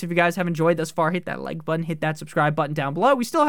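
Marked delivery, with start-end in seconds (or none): none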